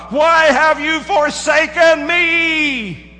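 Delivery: high, loud, very slow anguished plea; child crying out to his leaving parents. Acted. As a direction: cry it out like a child abandoned. Dying child cries out to parents.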